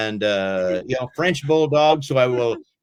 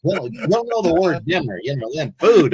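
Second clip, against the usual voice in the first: silly pet voice, a bit higher; dog voice; 4 semitones higher, 3 dB louder than usual. Pretend. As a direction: play the confused dog thinking aloud. Voicing the dog's thoughts.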